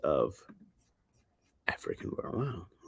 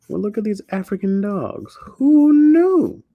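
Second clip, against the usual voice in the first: much higher, louder, drawn-out who knew; mock amazement; 13 semitones higher, 10 dB louder, slower than usual. Real, not acted. Streamer looks at African dogs, loud mock amazement. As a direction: ham up the astonishment.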